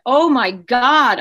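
reenacted anguished outcry; retold scream. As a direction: stretch it out slowly in alarm, a contained scream. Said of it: "I was screamed".

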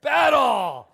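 full-throated yell, high and loud; inner battle cry. Retold or imitated. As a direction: shout it like charging into a fight. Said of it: "That's what I kept hearing".

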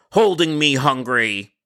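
slow, slightly put-on insistence; a touch of an odd customer. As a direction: spell out the strange phrase stubbornly.

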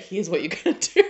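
fast words cracking into a laugh. Real, not acted. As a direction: rush it and lose it to laughter. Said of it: "I'm laughing".

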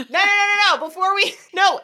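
high, loud playful objection; excited protest. Real, not acted. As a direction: stop her, laughing and eager. Stopping friend from moving on.

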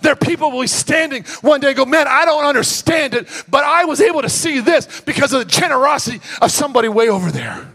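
loud, high sermon climax; full-force preaching. Real, not acted. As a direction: drive it home like a revival preacher.

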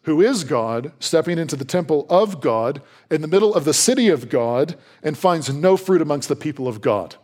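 normal talking voice

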